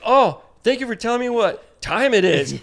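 high, loud mock-polite sarcasm; hammed-up sarcasm; sarcastic thanks. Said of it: "Corey's like".

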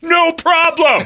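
high, loud, slow nervous yell; awkward shout. Retold or imitated. Fan answering naked Mark Grace, high slow awkward shout. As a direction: blurt it out way too loud. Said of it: "I shouted".

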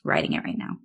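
low, unvoiced whispered aside; whispering. Real, not acted. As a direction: whisper it as a dry joke. Deadpan joke aside.

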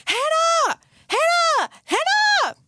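very high, loud screamed name; shrill-pitched repeated yell, reenacting a fall; screaming for help. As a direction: scream out for your friend from the floor. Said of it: "I'm on the floor screaming".

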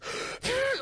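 very high mock whistle squeal; imitated whistle blast. Co-host imitating the manager's whistle in a face, high squeal. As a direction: imitate a piercing whistle without real volume. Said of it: "he just fucking wails on that whistle".

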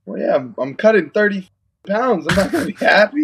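snippy attitude. Retold or imitated. Fighter answering his dad with snippy attitude. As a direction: give your dad some lip. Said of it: "I'm giving him attitude".

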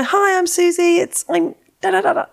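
high-pitched mock presenter's greeting; podcast voice. Mocking her own early show intro, high podcast voice. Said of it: "using my podcast voice".

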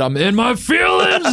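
mock coach yell. Co-host quoting the coach's line again, a mock shout. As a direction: yell it like an overgrown kid in a sulk. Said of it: "He said".

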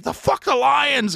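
high, slow shouted taunt; mock shout. Locals yelling at the Detroit tourists, high slow shout. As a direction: yell it at them. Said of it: "they're like".

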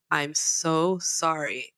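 low, slow imitated apology; mock contrite, drawn-out sorry; meek apology. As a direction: mimic a sheepish sound guy. Sound guy apologising to husband.

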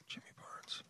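barely audible whisper. Real, not acted. Man looking up [name], whispering to himself. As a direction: whisper it to yourself. Muttering to himself while searching.

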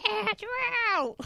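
high called-out name; calling out. Retold or imitated. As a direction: call his name across the house. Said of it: "I shouted the".

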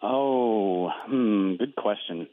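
slow, musing hmm; thinking hmm. Real, not acted. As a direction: stall while thinking it over. Guest stalls before answering.

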